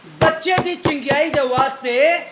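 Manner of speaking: high, ringing shouts in measured phrases; full-force preaching. Real, not acted. (ਪੂਰੇ ਜੋਸ਼ ਨਾਲ ਉੱਚੀ ਸੁਰ ਵਿੱਚ ਪ੍ਰਚਾਰ)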